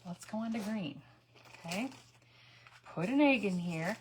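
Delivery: normal talking voice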